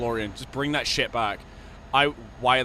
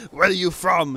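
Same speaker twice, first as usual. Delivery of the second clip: louder, higher put-on Arnold; 6 semitones higher, 8 dB louder than usual. Arnold returns to the call, loud put-on voice. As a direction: boom it like Arnold. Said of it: "He goes".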